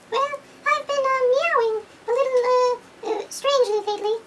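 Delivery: the toy cat drawing out its odd symptom, way up high; Mister Cat. Pretend. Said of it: "Mister Cat".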